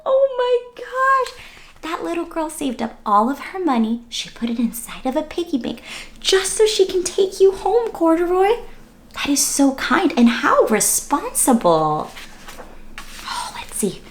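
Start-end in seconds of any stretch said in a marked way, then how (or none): 0.1-1.4 high, slow amazed gasp of words; touched amazement. Acted. Reader moved by girl's saving. 13.2-14.0 soft storyteller whisper. Acted. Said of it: "hushed before page".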